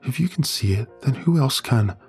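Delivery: high, tearful voice near breaking; words catching in a tight throat; choking up. Acted. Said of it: "She chokes out her words".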